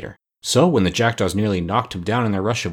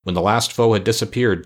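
usual speaking voice

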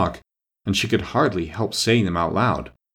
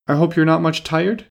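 polite question; 7 semitones higher than usual. A narrator's reading. Alice politely asks.

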